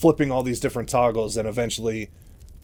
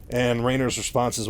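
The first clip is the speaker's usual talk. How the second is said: normal talking voice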